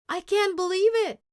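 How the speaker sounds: ordinary delivery, nothing marked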